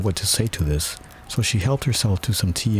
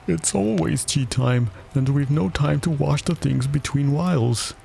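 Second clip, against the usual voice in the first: sighing; 7 semitones higher than usual. A narrator's reading. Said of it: "said the Hatter with a sigh".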